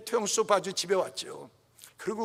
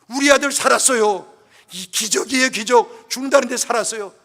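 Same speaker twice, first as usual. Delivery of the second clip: elder's joyful exclaim; 3 semitones higher, 8 dB louder than usual. Retold or imitated. Elder joyfully shouting son lived.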